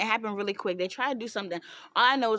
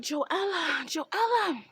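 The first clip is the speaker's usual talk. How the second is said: pleading call; 7 semitones higher, much slower than usual. Retold or imitated. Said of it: "Recycle is like".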